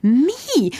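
high, bewildered stammer; flabbergasted high-pitched squeak. A narrator's reading.

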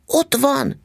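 excited shout. A narrator's reading. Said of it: "kiáltottam".

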